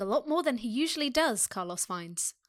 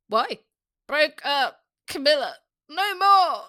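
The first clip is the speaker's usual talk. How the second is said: loud, hammed-up drunken misery; drunk sadness; 3 semitones higher, 6 dB louder, slower than usual. A narrator's reading. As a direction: wail it like a heartbroken drunk. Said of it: "turning into one of sadness".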